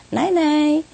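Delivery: high sing-song goodnight; lilting parrot mimicry; parrot saying night-night. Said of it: "Exactly like my voice".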